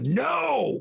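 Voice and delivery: high, drawn-out yell; yell at them. Acted. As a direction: yell one long defiant word at the enemy. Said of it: "And just yell at them".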